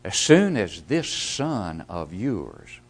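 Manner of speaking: slow and disdainful, leaning on "this son of yours"; contemptuous tone. Shown as a demonstration. Preacher voicing the resentful elder brother's scorn. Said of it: "get the intonation of the voice".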